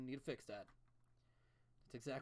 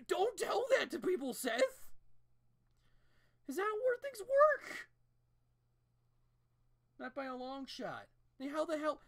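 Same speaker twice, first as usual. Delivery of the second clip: much higher, louder, incredulous yelling; outraged shout; 13 semitones higher, 10 dB louder than usual. Real, not acted. Telling off Seth MacFarlane, loud incredulous outrage. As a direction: yell at Seth in disbelief, stretching it out.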